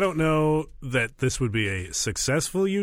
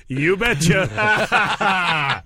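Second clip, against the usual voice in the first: very slow, higher, drawn-out catchphrase; show catchphrase; 4 semitones higher, 4 dB louder, much slower than usual. Pretend. Host answers whether this person is a witch, drawn-out hammed-up catchphrase. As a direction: stretch it out like a cheesy show host. Acting out show's catchphrase, laughing.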